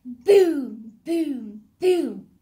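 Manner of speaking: loud, heavy "boom" for each step; booming footsteps. A narrator's reading. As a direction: boom out a giant's footfalls. Giant's footsteps imitated in story.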